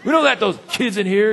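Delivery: high-pitched snooty scolding, played for laughs; hammed-up disapproval; mock rude usher. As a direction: imitate an unfriendly usher turning kids away. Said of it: "no, that's not how we talk".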